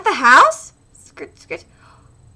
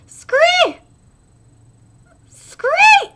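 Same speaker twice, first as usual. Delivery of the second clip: creature cry; 6 dB louder, much slower than usual. Acted.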